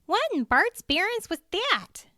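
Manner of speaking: high, loud startled exclamation; alarmed cry. A narrator's reading. Cheryl crying out to Andy about the creature, alarmed. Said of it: "Cheryl cried".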